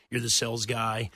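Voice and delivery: ordinary talk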